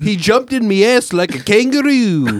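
hammed-up Australian complaint; Australian accent. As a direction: protest in a broad Australian accent. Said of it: "take anyone with an Australian accent".

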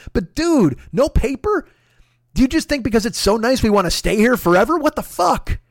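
ordinary talk

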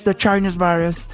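lightly voiced English Trump mimic; mocking Trump. Mocking Trump saying the Chinese virus, lightly mimicked. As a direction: parody the phrase in a hint of Trump. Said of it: "Y dicen".

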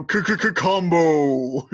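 slow stuttered k-k-k-combo; game announcer combo. Pretend. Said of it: "can we add sound effects?".

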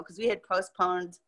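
ordinary talk, nothing marked

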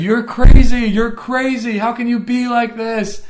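high, loud yell, slowing at the end. Retold or imitated. Reliving yelling at God in the woods, loud high yell. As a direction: shout up at the sky in disbelief. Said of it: "pointed and yelled".

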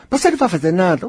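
high skeptical inner voice; doubting inner voice. Acted. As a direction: play the nagging doubt that questions you. Doubting inner voice challenges speaker.

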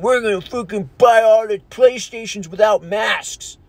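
changed, ranting voice; mock angry shopper. Mocking maskless PlayStation buyer, ranting and changed. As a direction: brag like a belligerent shopper. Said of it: "Don't run into the mom and".

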